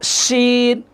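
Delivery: drawn-out hushing শিন; inner-mouth shin. Shown as a demonstration. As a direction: say শিন with sh, tongue off the teeth. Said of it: "এই যে".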